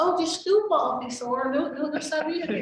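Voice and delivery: high, loud, exaggerated Cuban Spanish; thick Cuban accent, hammed up. Pleading with a police officer in an overdone Cuban accent. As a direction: lay the accent on thick to play dumb. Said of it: "Just really make your accent".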